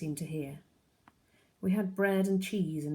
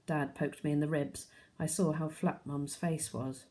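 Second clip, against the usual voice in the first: plain reading voice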